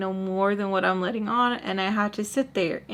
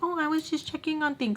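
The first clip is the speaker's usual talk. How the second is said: higher, falsely sweet mock voice; mock innocent excuse; 8 semitones higher than usual. Baltimore making excuses for coming upstairs, falsely sweet mock voice. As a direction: play the sneaky innocent snoop. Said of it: "Baltimore to come upstairs and be like".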